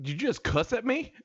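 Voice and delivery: high-pitched mock-indignant question; incredulous, high comeback to the neighbor; retold disbelief. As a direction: replay the affronted challenge, voice shooting up. Acted confrontation with neighbor.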